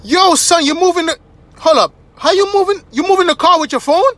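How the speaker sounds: high, loud disbelieving outburst; hammed-up shock, firing questions; flabbergasted attendant. Attendant can't believe phone moves the car, high loud disbelief. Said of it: "But he was like".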